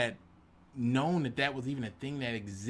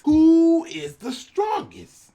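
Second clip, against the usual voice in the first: very high, very loud, drawn-out slow bellow; booming inner shout; 12 dB louder, much slower than usual. Retold or imitated. Boasting to his audience, an inner-thoughts battle cry. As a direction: roar it like an anime villain. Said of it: "what I was thinking was".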